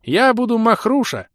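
put-on squeaky proud old creature. A narrator's reading. Old creature introduces himself.

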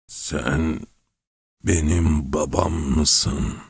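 put-on soft, slow worn-out old man; cracked old voice. A narrator's reading. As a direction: speak like a frail, tired old man. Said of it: "çatallaşmış ve yıpranmış bir sesle".

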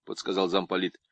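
ordinary delivery

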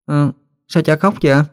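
put-on high-pitched puzzled child's question; surprised child. A narrator's reading. Child sees father crying, high surprised question. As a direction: ask it high, like a startled little kid. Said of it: "Hân ngạc nhiên hỏi".

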